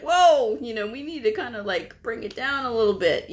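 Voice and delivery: high "whoa", then easing down; startled protest. Retold or imitated. Coder turning to a yelling provider, startled protest. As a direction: try to calm someone who burst in yelling.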